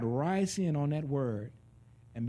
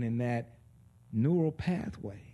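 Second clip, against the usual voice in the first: ordinary talk, nothing marked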